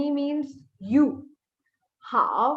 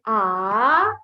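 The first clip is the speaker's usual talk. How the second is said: long rising AH; second tone; 3 semitones lower, 4 dB louder than usual. Shown as a demonstration. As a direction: start AH low and glide it up. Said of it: "you go from up".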